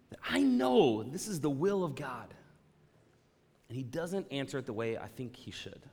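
usual speaking voice

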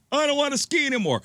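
put-on a hurt boy refusing to ski on; whiny kid. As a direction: whimper it like a scared boy.

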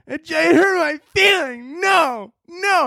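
very high mock wail; whining complainer. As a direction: drag out the hurt in a hammed-up whine. Same offended complainer voice.

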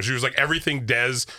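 ordinary talk, nothing marked

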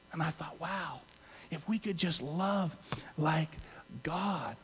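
very soft, halting wonder; quiet, reflective realization; hushed awe. Retold or imitated. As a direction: think it aloud softly, with pauses. Awed reflection after God's answer.

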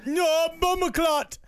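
mock Jamaican accent. Comedian asked to do Jamaican, slow mock Jamaican accent. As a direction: drag out a hammed-up Jamaican curse. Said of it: "I haven't done a Jamaican accent".

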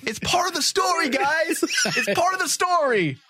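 gleefully yelled excuse; excited high laughing shout. Pretend. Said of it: "I'm like".

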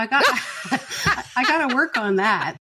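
normal talking voice

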